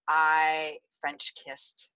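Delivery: drawn out. Acted. Coy build-up to juicy confession.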